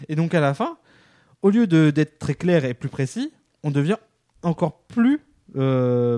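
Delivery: ordinary talk, nothing marked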